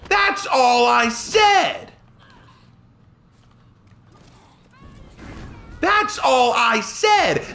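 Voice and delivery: mad, high-pitched protest; getting mad. Real, not acted. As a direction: drag out each word in real exasperation. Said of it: "I'm getting mad".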